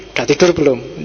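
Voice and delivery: unfriendly text message read aloud; unpleasant reading. Shown as a demonstration. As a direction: ask it brusquely, without any friendliness. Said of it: "Itu kan nggak enak".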